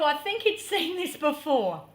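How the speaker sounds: ordinary talk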